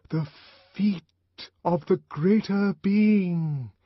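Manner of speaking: high, slow, hushed intoning; penetrating stage whisper. A narrator's reading. The voice sinks before listeners, high penetrating stage whisper. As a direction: hush it but make it pierce, drawing out each word. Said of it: "sunk to a whisper".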